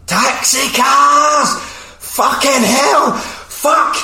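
very high, loud, drawn-out mock scream; hammed-up meltdown, every word shrieked; screaming down phone. Driven-mad taxi operator screaming at prank callers, hammed up. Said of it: "this guy is screaming down the phone".

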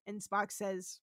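normal talking voice

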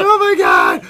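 high, loud cry through hilarity; reenacted hysterical laughing fit; laughing apology. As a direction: shriek an apology while cracking up. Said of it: "I'm laughing so hard".

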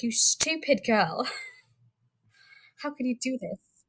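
hammed-up British accent with a laugh; thick British accent. Co-host imagining Levana in a thick British accent, scolding. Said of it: "a thick British accent".

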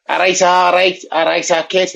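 hammed-up Liverpool Scouse accent, all right all right kid; a playful, slightly overdone Scouse greeting. Caller trying out accents on a podcast voicemail, mock Liverpool accent. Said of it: "It's Liverpool".

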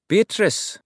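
high, loud call across the room. Acted. Lowry calling out to Bea across the waiting room, high and loud. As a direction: call her name in glad surprise. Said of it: "Lowry called".

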